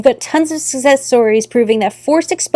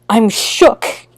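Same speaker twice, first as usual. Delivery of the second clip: ordinary talk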